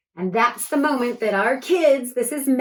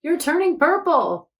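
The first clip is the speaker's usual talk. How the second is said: much higher, louder exclaim; surprised shout; 8 semitones higher, 4 dB louder than usual. A narrator's reading. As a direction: burst out in amazement. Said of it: "shouted Akiko in surprise".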